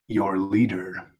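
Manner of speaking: slow, stern Batman voice. Acted. Batman demands the leader from a dazed mutant, slow and stern. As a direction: draw it out as a menacing hero.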